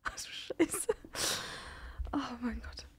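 frightened breathy whisper. Real, not acted. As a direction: whisper in shock. (erschrocken geflüstert)

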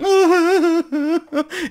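high, loud hammed-up crying wail; mock sob. Imitating Torres crying with emotion, loud mock wail. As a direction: imitate a tearful winner. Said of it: "estaba todo emocionado llorando".

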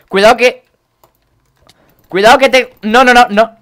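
high, loud panicked warning; alarmed shout. Real, not acted. Gamer warning as danger closes in, alarmed shout. Alarm as the game goes wrong.

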